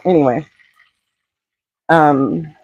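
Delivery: ordinary talk, nothing marked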